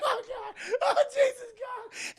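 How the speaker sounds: very high, whimpering mock panic; panicked wailing. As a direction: wail as you flee, hammed up. Father runs out panicking.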